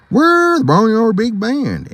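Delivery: proud pig. Acted. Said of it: "answered a pig".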